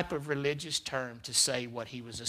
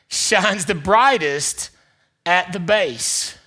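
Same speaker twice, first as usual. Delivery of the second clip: slow and louder, each word weighted; slow emphatic retake; 3 semitones higher, 6 dB louder, slower than usual. Shown as a demonstration. Pastor rereads the quote's end with slow weight. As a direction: land it slowly. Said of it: "The light that shines the fur".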